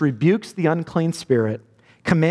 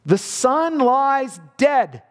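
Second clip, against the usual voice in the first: slow solemn line; 7 semitones higher, much slower than usual. Acted. Dramatic sermon climax.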